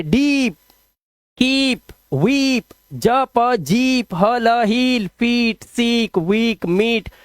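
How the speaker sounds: each word with a stretched "eee", like डीप, कीप; long vowel drill. Shown as a demonstration. Teacher drilling double e words, long vowel drill. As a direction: read the list slowly, holding every ई long. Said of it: "long vowel sound निकालना".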